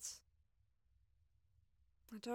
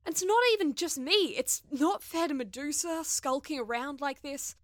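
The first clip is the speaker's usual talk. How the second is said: loud, indignant venting; fuming rant; 9 dB louder than usual. Acted. Crewmate vents alone about her stubborn friend, fuming. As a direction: let the frustration pour out, voice raised.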